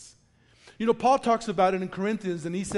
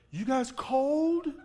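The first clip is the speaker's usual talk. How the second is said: doting dog talk; 6 semitones higher, much slower than usual. Retold or imitated. Friend talks to shivering dogs.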